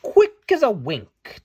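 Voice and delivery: ordinary delivery, nothing marked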